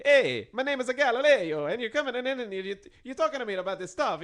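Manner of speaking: high, loud mock Italian accent. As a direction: ham up a cartoon Italian voice. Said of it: "an Italian accent".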